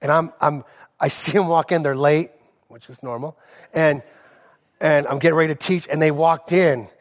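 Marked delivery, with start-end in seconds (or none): none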